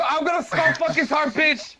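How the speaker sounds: high excited shout; hyped yell. Real, not acted. Hyped reply to friends' taunt.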